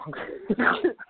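quick airy laugh at his own joke; a short unvoiced chuckle; breathy laugh. Real, not acted.

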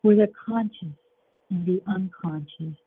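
guided meditation. Acted.

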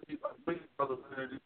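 choppy, dropping out on the phone; phone line breaking up. Real, not acted. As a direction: voice cutting out on a bad line. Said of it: "Line breaking up".

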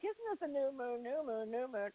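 soft, puzzled murmur; softly retold confusion, trailing away; confused mutter. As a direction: mumble it to yourself, lost. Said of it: "I'm like".

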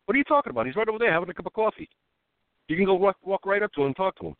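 mock the locals who can't believe it; high, quick disbelieving quote; incredulous locals. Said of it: "And they're like".

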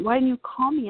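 high, pressing why-didn't-you; mock urgent reproach; alarmed question. Surgeon asking the patient why she never called, alarmed.

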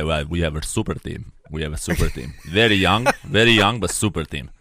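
low, soft mocking imitation of a smug GM; mock Divac voice. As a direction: drone it out low like a hungover executive at the podium. Mocking Vlade Divac at podium.

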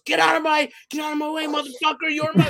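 high mock shout of a furious wrestler; retold angry yell. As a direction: bark it like she's shoving you aside. Wrestler yells at photographer ringside.

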